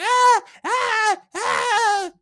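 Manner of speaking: high, drawn-out mock screams; imitated crowd screaming. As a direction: mimic panicked bystanders screaming. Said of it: "Everybody screaming".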